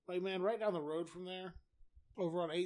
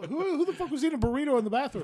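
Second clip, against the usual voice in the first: loud outraged shout, higher; mock outraged yell; 9 semitones higher, 11 dB louder than usual. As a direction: yell it across the shop in disbelief. Outraged coworker yelling about burrito.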